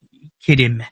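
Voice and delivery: usual speaking voice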